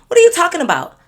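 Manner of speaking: high, loud disbelief; can't believe what she's hearing; incredulous. Retold or imitated. Baffled reaction to her friend's question.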